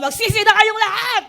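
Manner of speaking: shouted high and loud, half laughing; angry shout. Retold or imitated. Preacher mimics John the Baptist yelling repent at the crowd, mock angry shout. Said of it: "Laging galit, sumisigaw".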